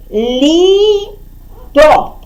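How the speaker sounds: ЛІТО split into лі and то, pause between; slow separate syllables in Літо. Shown as a demonstration. Teacher dividing the answer for children, ЛІТО syllable by syllable. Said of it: "Літо ділимо на склади".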